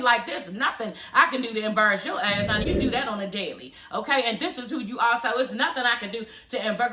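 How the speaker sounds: ordinary talk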